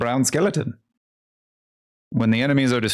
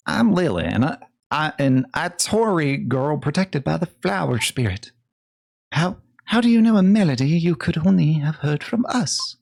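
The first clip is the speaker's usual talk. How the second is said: put-on lightly raised young girl voice; 6 semitones higher than usual. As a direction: voice a gentle young girl, a bit higher. Voices character Lily.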